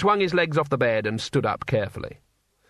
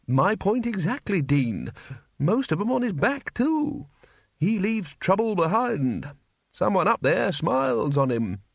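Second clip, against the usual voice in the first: put-on higher, slightly slow old don insisting; a hint of a fussy old wizard; lightly voiced old wizard; 7 semitones higher than usual. Dean-like wizard presses his point.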